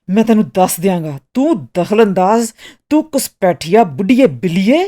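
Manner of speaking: loud, raging threat and insults; angry yelling. A narrator's reading. Ruined artist berating the meddling Miss Martha, shouting angrily. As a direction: hurl the insults loudly and furiously. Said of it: "ਮੁੱਕੇ ਮਾਰਦਿਆਂ ਚੀਖਿਆ".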